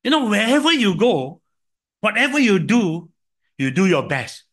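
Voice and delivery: put-on earnest older sister. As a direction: press the advice on a young brother. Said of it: "She said to me".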